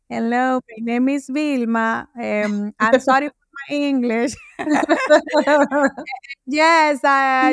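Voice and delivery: mock nervous self-introduction in English, laughing through it; insecure English intro. Nervous speaker introducing herself at an American pitch, laughing awkwardly. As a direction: play her timid, apologetic younger self. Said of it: "es bajar el tono de voz".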